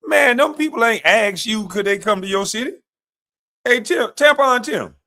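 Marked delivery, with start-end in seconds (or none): 3.6-4.9 taunting call. Real, not acted. Taunting the governor by nickname.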